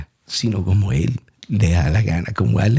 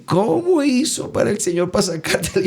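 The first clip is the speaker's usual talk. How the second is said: laughing mock question; 10 semitones higher than usual. Mimicking people's question, laughing.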